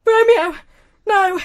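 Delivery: loud, high mock-tragic wail; hammed-up grief; tragic cry. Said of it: "Tragic romance".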